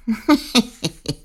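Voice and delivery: quiet mean cackle; the witch sniggering at his excuse; soft witch snigger. Acted. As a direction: snicker softly like a witch. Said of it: "Elle ricane doucement".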